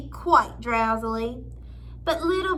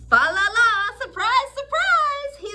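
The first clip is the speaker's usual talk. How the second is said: rising, much higher sing-song; joyful sing-song surprise; 9 semitones higher, 5 dB louder than usual. A narrator's reading. Missus Tim finding the tree, singing out a joyful surprise. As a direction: sing it out, building in delight.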